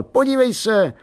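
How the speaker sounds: put-on high boyish call to mum. A narrator's reading. Boy calling his mother to watch, calling out.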